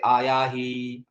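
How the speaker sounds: आया ही drawn out slowly, vowels held long; slow, stretched आया ही with held vowels; prolonged pluta vowels. Shown as a demonstration. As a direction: elongate each vowel of आया ही. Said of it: "प्लुत उच्चारण".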